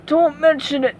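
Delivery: character voice breaking into a cough; reply, then cough dryly; parched words ending in a cough. A narrator's reading. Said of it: "Patrick coughed".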